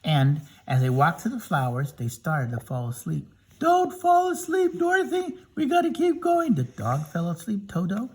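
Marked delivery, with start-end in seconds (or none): 3.6-6.5 high character voice. Acted. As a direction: plead way up high to keep her awake. Friend urges Dorothy in poppies.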